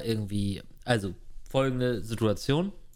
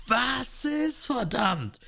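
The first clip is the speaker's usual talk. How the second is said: high, exasperated shout; angry yell; 11 semitones higher, much slower than usual. Retold or imitated. Husband yelling at wife who won't answer, irritated shout. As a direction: bark it up at her, annoyed. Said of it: "richtig geschrien".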